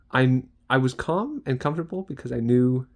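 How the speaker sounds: ordinary delivery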